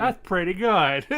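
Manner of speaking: high, loud, mock-impressed. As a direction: say it as if the sour candy is nothing. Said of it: "And I'm sitting here like".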